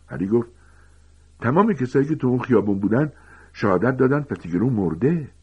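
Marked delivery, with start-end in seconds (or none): 1.4-5.3 raised, higher-pitched objection; heated protest. Acted. Harry disputes that Pettigrew lives, heated and insistent. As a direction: argue back insistently, pitch up.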